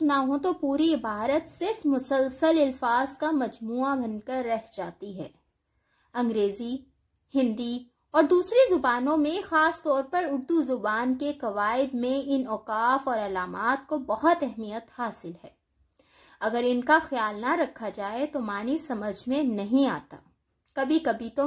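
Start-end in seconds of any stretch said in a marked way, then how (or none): none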